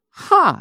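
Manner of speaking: sudden high, loud "ha!"; mock scoff. Pastor capping his wife's challenge, acted loud scoff. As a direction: burst out with one sharp scoffing syllable.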